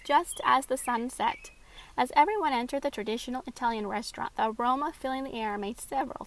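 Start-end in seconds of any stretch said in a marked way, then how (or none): none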